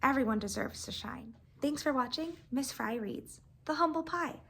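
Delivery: usual speaking voice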